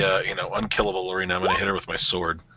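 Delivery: ordinary talk, nothing marked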